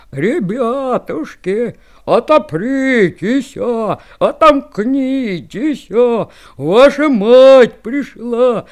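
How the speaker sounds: slow, loud rough singing wolf; wolf's rough voice. Acted. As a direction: belt it out like a coarse wolf. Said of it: "а волк грубым голосом и запел".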